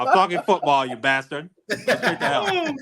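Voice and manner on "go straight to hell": high, drawn-out curse through laughter; laughing curse. Real, not acted. Teased friend cursing his buddy back, laughing. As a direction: crack up while telling a friend off.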